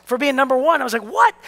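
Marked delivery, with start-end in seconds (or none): 1.0-1.3 high-pitched disbelieving yelp; mock astonishment. Young winner discovering a bonus check, retold high-pitched shock. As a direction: squeak it out in amazement.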